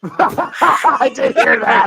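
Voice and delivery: talking through laughter; amused, slow reply broken by laughs; laughing. Real, not acted. Agreeing he heard Jason's yelp, laughing.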